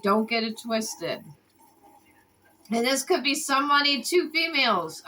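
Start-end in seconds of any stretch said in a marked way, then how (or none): none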